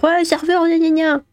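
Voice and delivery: high, whiny mock voice; mocking quote. Streamer mocks an imagined complaint about the waiter, high and whiny. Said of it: "On va pas dire".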